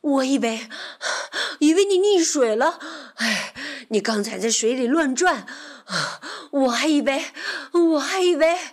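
loud, breathless worried voice; panting. A narrator's reading. Rabbit who feared his friend had drowned, panting hard. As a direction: gasp between broken phrases. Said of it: "蹦蹦喘着粗气".

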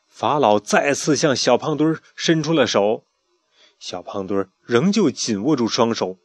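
ordinary delivery

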